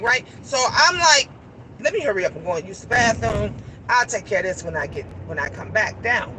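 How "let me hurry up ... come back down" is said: quick, low, softer self-talk; hurried aside. Retold or imitated. Woman hurrying to the bathroom, quick low aside. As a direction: rattle it off low as if to yourself.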